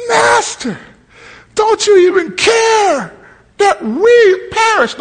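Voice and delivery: high-pitched, desperate mock cry; hammed-up terrified plea; scared to death. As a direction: wail it like a panicked man in a storm. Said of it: "You scared to death of dying".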